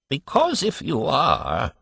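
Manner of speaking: put-on querulous old man. A narrator's reading. Old man in the crib complaining to his father, querulous. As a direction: grumble like a peevish old man. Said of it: "went on the old man querulously".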